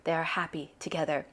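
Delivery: usual speaking voice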